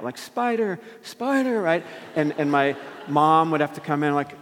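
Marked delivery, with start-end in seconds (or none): 0.2-1.6 high-pitched retold night-terror cry. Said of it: "waking up, crying out".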